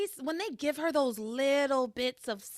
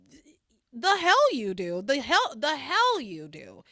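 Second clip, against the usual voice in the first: louder, indignant mock snap; angry retort; 3 semitones higher, 7 dB louder than usual. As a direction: push back hard, loud and defiant. Rebecca angrily cuts Julian off.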